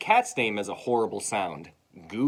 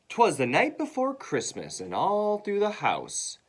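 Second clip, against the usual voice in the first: higher, lilting storybook voice; storybook read-aloud; 9 semitones higher than usual. Acted. Teacher reads Christmas poem to class, lilting read-aloud.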